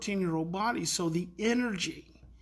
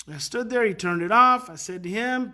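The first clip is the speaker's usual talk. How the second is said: normal talking voice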